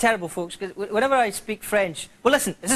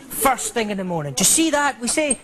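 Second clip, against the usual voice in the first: usual speaking voice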